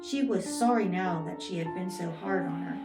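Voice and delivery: ordinary delivery, nothing marked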